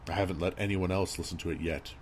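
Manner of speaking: ordinary delivery, nothing marked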